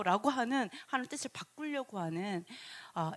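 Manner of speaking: normal talking voice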